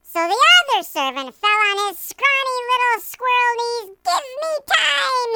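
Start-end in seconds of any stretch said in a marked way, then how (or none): 4.0-5.3 high, slow pleading voice; begging. A narrator's reading. Other servant on his knees, begging high and slow. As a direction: plead for mercy, drawing it out. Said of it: "he begged".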